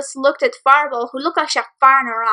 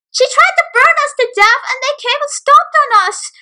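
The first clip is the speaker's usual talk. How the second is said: much higher, whining accusation; whiny bully accusing; 13 semitones higher, 5 dB louder than usual. Acted. Bullies tattling to the principal, a high whine.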